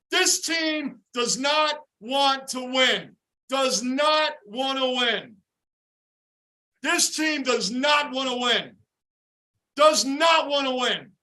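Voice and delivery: slow, hammering each word; slow disgusted emphasis. Real, not acted. Fan declaring his team doesn't want to win, slow emphasis.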